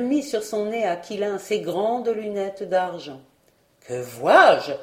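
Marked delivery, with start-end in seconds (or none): 3.9-4.7 a loud startled outcry; exclaiming. A narrator's reading. Aunt spotting the missing cloud, loud exclamation. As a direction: cry out in surprise, louder. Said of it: "s'écria-t-elle".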